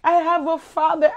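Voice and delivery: usual speaking voice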